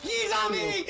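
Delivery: high panicked yell; panicking gamer. Real, not acted. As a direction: shriek as the killer grabs you. Killer catches him.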